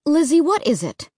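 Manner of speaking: impatient mother cutting in. A narrator's reading. Mom answers her frightened daughter, impatient. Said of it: "Mom asked impatiently".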